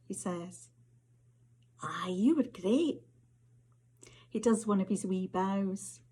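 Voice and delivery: measured, intimate Scottish storytelling read; story narration. A narrator's reading. Author reading to an audience, story narration. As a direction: author reading her flash fiction aloud, unhurried and close. Reads second story aloud.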